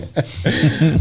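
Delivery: high bird call imitation; sparrow chirp. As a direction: trill like a migrating sparrow. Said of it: "the migrating plaid sparrow".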